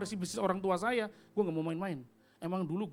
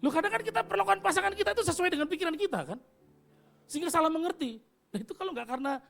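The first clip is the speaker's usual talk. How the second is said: usual speaking voice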